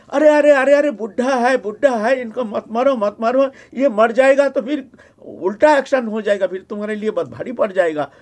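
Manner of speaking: high, loud, urgent acted protest; a wife rushing in to stop a beating; alarmed pleading. Wife stops beating of old husband.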